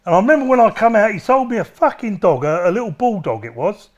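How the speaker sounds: usual speaking voice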